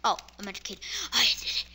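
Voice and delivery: ordinary talk, nothing marked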